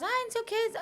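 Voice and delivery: high, dismissive mock whine; mocking whiny commenter. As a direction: brush it off like a careless commenter.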